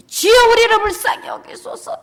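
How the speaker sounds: high, loud cry for mercy; begging the passing Jesus out loud; loud prayer. Acted. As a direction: shout the plea upward. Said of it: "이렇게 기도했어요".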